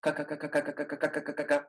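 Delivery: a quick run of ka from the back of the tongue; rapid back-tongue ka. Shown as a demonstration. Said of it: "with the back of the tongue".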